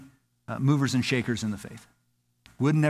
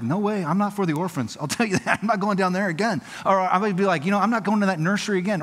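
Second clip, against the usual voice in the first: higher, animated refusal; mock protest; 6 semitones higher than usual. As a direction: push back in a higher voice, playing the reluctant volunteer. Acting out refusals to serve.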